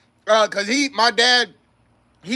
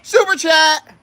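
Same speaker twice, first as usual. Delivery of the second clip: shout; 4 semitones higher, 3 dB louder, slower than usual. Acted. Echoing super chat call.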